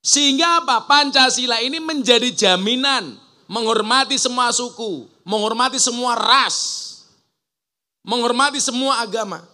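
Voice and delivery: loud, high-pitched speech to a crowd; impassioned oratory. Real, not acted. Speaker rallying the crowd on respect for every group, impassioned oratory.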